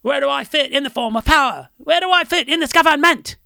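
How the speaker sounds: high, loud English punk sneer; mock punk English accent. Quoting TSOL lyrics to his cohost in English accent. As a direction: belt the lyrics like a snotty English punk singer.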